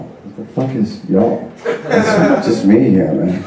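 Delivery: usual speaking voice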